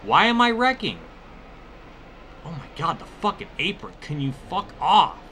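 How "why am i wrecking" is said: ordinary talk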